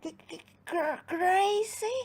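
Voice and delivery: high stuttering question; fearful stammer. Acted. Frightened gnomes stammer back at the giant. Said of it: "said the gnomes".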